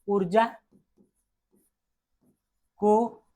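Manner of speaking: word by word, long pauses; dictation. Real, not acted. Teacher dictating the energy law to students, slow dictation. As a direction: dictate very slowly for note-taking.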